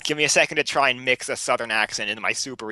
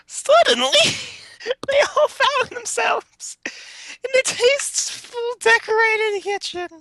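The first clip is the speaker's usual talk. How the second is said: high, squeaky, struggling to get words out; reading through laughter; 4 dB louder than usual. Real, not acted. Reader carrying on with the story, laughing hard. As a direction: narrate while cracking up.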